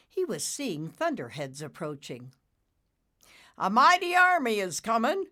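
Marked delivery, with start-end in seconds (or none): 3.6-5.2 much higher, loud proclaiming bull cloud; booming announcement. Acted. Bull cloud announces the army to Nimbus, loud and proud. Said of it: "Bullicus announced with a snort".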